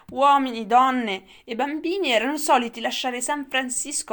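a nostalgic grandfather holding forth; old grandfather reminiscing. A narrator's reading. (nonno che rievoca il passato, più forte e acuto)

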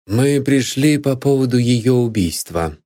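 ordinary delivery, nothing marked

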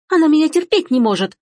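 high-pitched indignant squeal; squealed accusation. Acted. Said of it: "взвизгнула Эжени". (обвиняющий визг)

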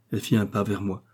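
usual speaking voice

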